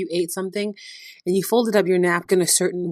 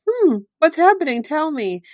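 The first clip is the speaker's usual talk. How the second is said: feigned innocence; 9 semitones higher than usual. Retold or imitated. Jesus playfully asking the disciples what happened, higher and mock-curious. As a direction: pretend not to know, teasing them to talk. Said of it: "he's like".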